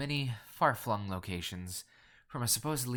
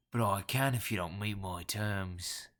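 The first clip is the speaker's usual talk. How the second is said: slow, throaty Cockney threat; barked Cockney; 2 semitones lower, slower than usual. A narrator's reading. Caller pressing his terms, in gruff Cockney. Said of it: "Brown barked".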